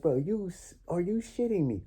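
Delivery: high-pitched, incredulous; disbelief. Retold or imitated. Recalling the cops stopping him, high disbelief. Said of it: "I'm like".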